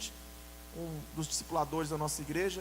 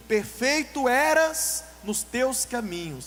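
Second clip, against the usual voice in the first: slow, louder scripture intoning; solemn scripture reading; 4 semitones higher, 7 dB louder, much slower than usual. Shown as a demonstration. Preacher reads Ezekiel aloud slowly to the church. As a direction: read the verse weightily, word by word. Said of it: "A palavra do Senhor diz".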